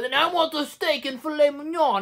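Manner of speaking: high, exaggerated put-on accent; mock an actor's wandering accent, pitched well up; Michael Keaton. Reviewer mocking an actor's switching accent, high put-on voice. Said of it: "he talks like this".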